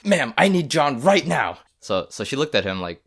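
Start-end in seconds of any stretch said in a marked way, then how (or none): 0.0-1.6 louder, slow, higher urgent call; urgent demand. Retold or imitated. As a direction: barge in demanding. Friend bursts in calling out.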